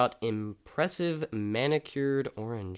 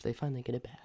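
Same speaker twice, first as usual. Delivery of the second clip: whisper; 7 dB quieter, much faster than usual. Acted. Whispered punchline aside.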